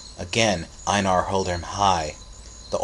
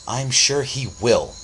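slow, higher-pitched defiant insistence; 5 semitones higher, 4 dB louder, slower than usual. A narrator's reading. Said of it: "said Mother defiantly".

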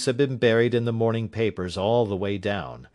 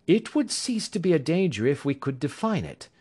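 higher, assured detective voice; Holmes; 7 semitones higher than usual. A narrator's reading. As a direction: Sherlock Holmes reassuring his worried client, higher assured voice. Holmes voice again.

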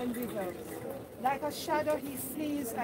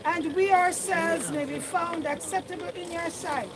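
raised, solemn prayer voice; fervent prayer; 5 semitones higher, 6 dB louder than usual. Acted. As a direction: lift the prayer up with full voice. Prays aloud over the grave.

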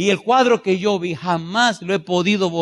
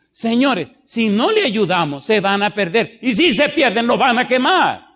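rising, louder warning from the pulpit; preaching at full force; 5 semitones higher, 4 dB louder than usual. Acted. Preacher warning the congregation, rising and louder. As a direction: build the warning toward the end.

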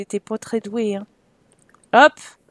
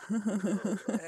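short laugh; 5 semitones lower, 11 dB quieter than usual. Real, not acted. Laughing at the failed flirt.

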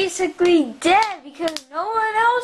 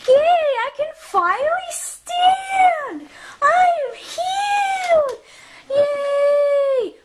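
higher, thrilled play cheering; excited cheer; 8 semitones higher, slower than usual. Pretend. Healed toy figure cheering to its friends, excited. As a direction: cheer like you just got better.

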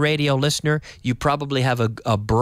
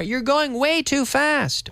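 mock scolding; 12 semitones higher, slower than usual. Acting driver scolding in car.

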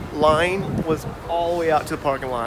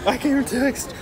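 shivering; 8 semitones higher than usual. Real, not acted. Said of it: "It is so flipping cold".

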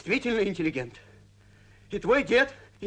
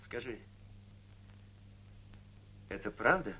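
low, quiet and careful; hushed question; 9 semitones lower, 14 dB quieter than usual. Acted. Grandfather questions granddaughter after quarrel, low and hushed. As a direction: ask it softly, in confidence. Grandfather privately asks about the lover.

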